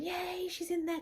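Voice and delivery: put-on high, soft child's cheer; excited yay. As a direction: imitate the daughter getting excited, softly.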